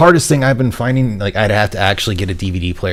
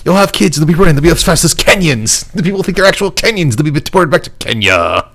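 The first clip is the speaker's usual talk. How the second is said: much higher, louder, over-the-top energy-drink pitchman; hyped ad voice; 9 semitones higher, 4 dB louder than usual. Retold or imitated. Quoting the Power Thirst ad to friends, manic high pitchman. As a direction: sell it like a manic drink commercial. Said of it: "I just love the power thirst ones".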